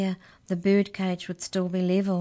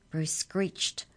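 ordinary delivery

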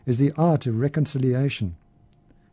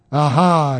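put-on loud drunken roar; 6 dB louder than usual. A narrator's reading. Drunk roaring at the foreigner, loud. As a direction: bellow it at the foreigner. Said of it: "he roared".

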